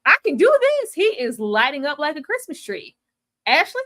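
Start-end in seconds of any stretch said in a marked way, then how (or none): 0.0-0.9 rising higher and louder with glee; giddy, talking himself into it; gleeful character. Retold or imitated. The character in the locker room, giddy rising glee. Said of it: "He's like".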